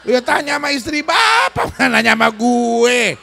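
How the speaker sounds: shouted, laughing comeback; loud retort. Real, not acted. Preacher shouting back at the complaining husband, loud and amused. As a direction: bark it out with a laugh.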